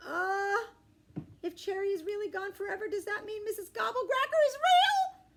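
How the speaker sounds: high, loud frightened wail; terrified. A narrator's reading. Child realizing the monster is real, high scared wail. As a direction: panic rising in the voice.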